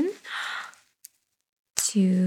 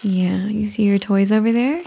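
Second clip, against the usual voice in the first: ordinary talk, nothing marked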